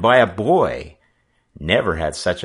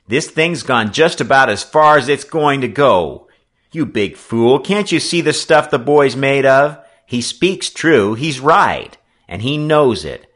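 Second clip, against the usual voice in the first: loud, raised, emphatic voice; heated rebuke; 7 semitones higher, 8 dB louder than usual. Acted. Sailor scolding the skipper for hitting the boy, heated. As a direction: drive each point home in the boy's defence.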